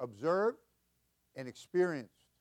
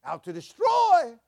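drawn-out, much higher and louder shout; preaching shout; 13 dB louder, slower than usual. Real, not acted. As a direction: hammer the word destroy home slowly. Preacher building to climax.

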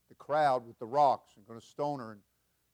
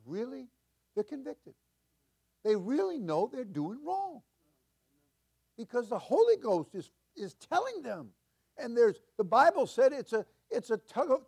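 higher and louder, rising preacher cadence; preaching at full force; 10 semitones higher, 5 dB louder than usual. Real, not acted. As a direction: lift the congregation with fervor.

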